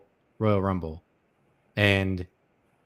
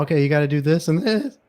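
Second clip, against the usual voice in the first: imitated voice crack, pitch climbing higher; 6 semitones higher, 4 dB louder, faster than usual. Telling people at work what to do, imitated cracking voice. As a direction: mimic your voice breaking upward mid-sentence. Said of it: "my voice has been cracking".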